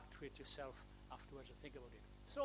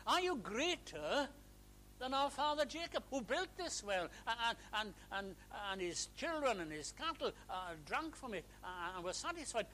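higher, indignant Samaritan woman; lightly voiced skeptical woman; challenging woman; 7 semitones higher, 3 dB louder than usual. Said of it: "and she says".

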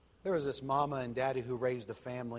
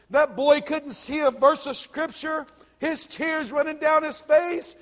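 preaching at full force; 11 semitones higher, 15 dB louder than usual. A narrator's reading. Pastor preaching the salvation scene to his church, full force. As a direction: hammer the climax home to the congregation.

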